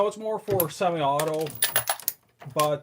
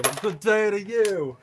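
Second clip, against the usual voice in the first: a playful, hammed-up British accent greeting; 5 semitones higher, 5 dB louder than usual. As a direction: greet the viewer like a cheerful Englishman, British accent. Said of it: "That was my accent".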